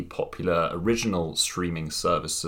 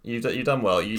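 ordinary talk, nothing marked

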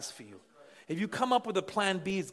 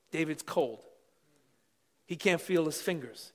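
ordinary talk, nothing marked

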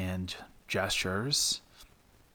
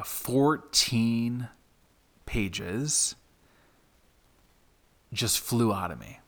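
ordinary delivery

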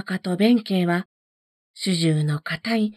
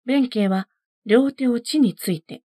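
ordinary delivery, nothing marked

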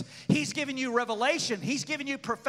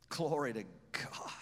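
breathy whispered praise; 11 semitones lower, 10 dB quieter than usual. Real, not acted. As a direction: whisper it in awe.

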